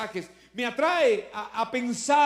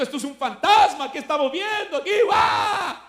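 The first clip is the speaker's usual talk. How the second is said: higher, louder mock fright with a laugh; mock scared shout; 7 semitones higher, 7 dB louder than usual. Preacher acting out the disciples seeing a ghost, mock scared shout. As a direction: ham up a terrified yell, half laughing. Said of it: "gritando de miedo".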